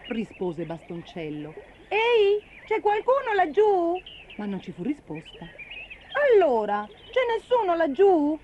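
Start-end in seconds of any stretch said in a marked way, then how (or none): 1.9-4.0 high, loud hail; raised, high searching call. Acted. Calling to someone unseen. 6.3-8.4 loud hail, rising high; insistent raised call. Acted. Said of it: "ripeté".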